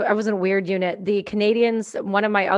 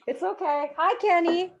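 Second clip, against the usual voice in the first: puppy talk; 9 semitones higher than usual. Real, not acted. Speaker greeting the office dog in high baby talk. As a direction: coo at the puppy.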